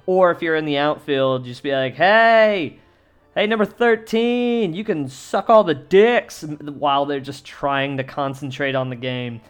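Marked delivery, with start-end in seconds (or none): 2.0-6.4 loud, high heckler's holler; shouted heckle. Acted. Fan taunts an outfielder during the game, shouted heckle. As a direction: holler it out at a player far off. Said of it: "just shout insults at the batter".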